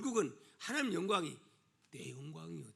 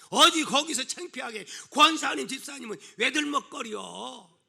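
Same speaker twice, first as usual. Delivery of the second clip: raised mock telling-off; acted scolding; 6 semitones higher, 9 dB louder than usual. Someone shaming church members quarrelling in a market, acted scolding. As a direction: scold them loudly, as in a public squabble.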